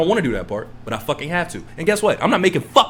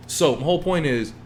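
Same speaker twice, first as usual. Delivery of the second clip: ordinary talk, nothing marked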